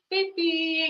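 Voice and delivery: put-on high little-kid "Baby"; imitating her toddler. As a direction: mimic the son calling her baby. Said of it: "he'll come up and be like".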